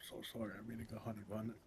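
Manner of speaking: talking through a mouthful; words round a bite of food; mouth full. Real, not acted. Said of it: "Why did it take such a big bite?".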